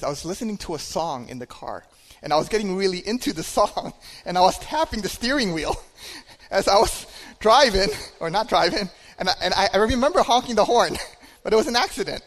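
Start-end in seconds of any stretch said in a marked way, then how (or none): 2.2-11.4 laughing. Real, not acted. Laughing at his own mistake. 11.4-12.2 quick, laughing, raised pitch; sheepish chuckle as he owns up; laughing admission. Real, not acted.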